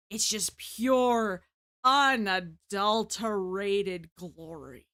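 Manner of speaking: slow, savoring each word; slow grandiose praise. Acted. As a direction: drag it out like a sermon. Mock reverent praise.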